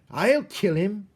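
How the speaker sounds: high, loud, slow menacing voice; wild jailer. A narrator's reading. Mig threatens the rat with her knife. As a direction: threaten with relish. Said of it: "shouted Meg, waving her knife".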